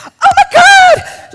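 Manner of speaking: high, loud squeal of delight, slowed; imitating a thrilled scream with a laugh; mock excited shout. Imitating the woman in the bathroom, loud excited squeal. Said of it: "Like this".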